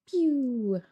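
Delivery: pew sound effect. Real, not acted.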